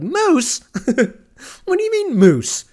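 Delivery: very high, loud incredulous moose question; incredulous squeak. Acted. Friend learning Andrew died by moose, squeaky disbelief. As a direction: shriek it in utter disbelief. Hearing the death was moose.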